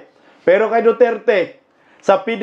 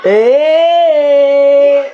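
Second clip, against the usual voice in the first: mock cheer; 2 semitones higher, much slower than usual. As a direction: shout it like a fake fan.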